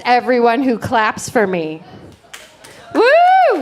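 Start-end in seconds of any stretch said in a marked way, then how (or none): 2.9-3.6 high, loud whoop; excited woo. Real, not acted. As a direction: cheer it out, way up high. Cheering the clapping crowd.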